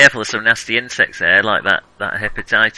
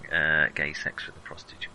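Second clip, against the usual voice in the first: whispered aside; 8 semitones lower, 9 dB quieter than usual. Real, not acted.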